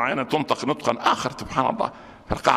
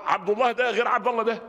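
ordinary talk

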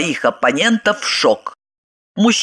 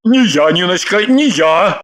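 mildly fearful man's denial; frightened babbling. A narrator's reading. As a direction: deny it with a touch of alarm. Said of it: "испуганно залепетал тот". (испуганный лепет)

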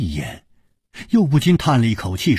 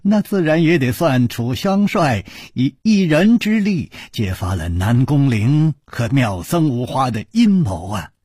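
usual speaking voice

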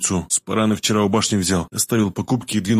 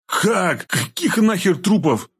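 high-pitched, shocked stammer; 10 semitones higher, much slower than usual. A narrator's reading. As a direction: stumble over the question, pitch jumping up. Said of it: "заикаясь спросил я". (заикаясь от потрясения)

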